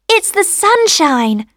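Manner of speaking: high, drawn-out sunny answer; cheery little voice. A narrator's reading. Sunshine answering at the keyhole, cheery. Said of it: "said the cheery little voice".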